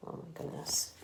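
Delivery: soft whispered oh my goodness; hushed aside. Real, not acted. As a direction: breathe it in wonder. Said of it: "reader's whispered aside".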